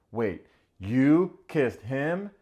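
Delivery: slow, loud disbelief; shocked. Retold or imitated. Nick learning his girlfriend kissed another guy, slow loud shock. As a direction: stretch it out in shock.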